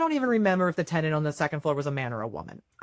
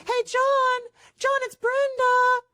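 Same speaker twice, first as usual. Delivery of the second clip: high, drawn-out sing-song call through the door; 3 dB louder, slower than usual. Retold or imitated. Landlord knocking, calling out.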